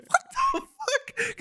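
very high, slow, laughing "What the fuck?"; imitating a startled lady mid-laugh; laughing mock outrage. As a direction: squeal it through laughter. Lady showered with crumbs.

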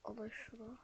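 soft unvoiced muttering; whisper. Real, not acted. Checking his inventory, whispering to himself. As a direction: breathe the words to yourself.

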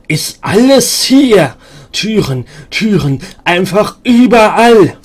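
slow, high, loud raving about doors; manic outburst. Acted. Madwoman Ingris raving to the stranger, high and loud. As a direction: cry it out like a woman gone mad.

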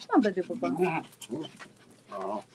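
slow, low, drawn-out coax to a puppy; low coaxing pet talk. Real, not acted. Coaxing her puppy to come over, slow low pet talk. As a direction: lure the dog over softly and slowly.

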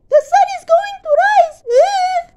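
squeaky very high squirrel voice, fast; piped up. Acted. Squirrel piping up beside bear, squeaky and high. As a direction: pipe it up cheekily. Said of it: "squirrel piped up".

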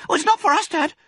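put-on very high, loud child voice; earnest fox cub. Smallest fox explains carrots to Dad, earnestly.

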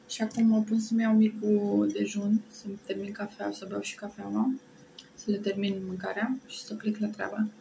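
speaking with food in mouth; eating between words; chewing while talking. Real, not acted. Seen 0: took bite just before; lips pressed, chewing between phrases, food held.

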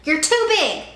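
high loud mocking bully; bully shouting. A narrator's reading. Sammy jeers at Kevin, mocking bully. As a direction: jeer like a bully. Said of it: "Sammy shouted".

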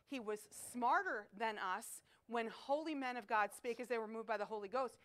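ordinary talk

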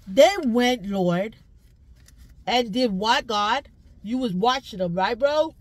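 loud, pleading call to God; loud prayer. Real, not acted. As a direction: cry out to the Lord, loud and fervent.

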